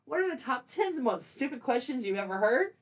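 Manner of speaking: normal talking voice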